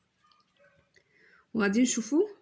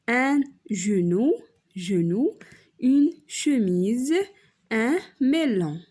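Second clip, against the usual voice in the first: slow words with the first syllable punched; slow stressed teacher demo; 4 dB louder, much slower than usual. Shown as a demonstration. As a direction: model each word slowly, leaning on it. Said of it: "Le son qui se répète". (نطق بطيء مع نبر قوي)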